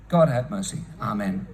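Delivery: low, subdued closing prayer; solemn prayer. Real, not acted. Speaker ending an appeal for Gaza, low and prayerful. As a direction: let the voice sink to a solemn amen.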